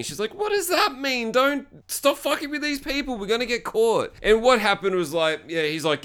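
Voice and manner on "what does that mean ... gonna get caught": very high pleading voice; high-pitched mock panic; imitated alarmed woman. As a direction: act her begging him to stop, pitch right up. She begs him to stop.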